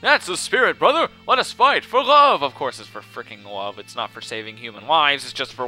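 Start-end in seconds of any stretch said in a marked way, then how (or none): 0.0-2.4 high, loud rallying shout; theatrical battle cry at a raised pitch; hammy heroic cry. Acted. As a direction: belt it out like an overblown hero. Hammy heroic game line.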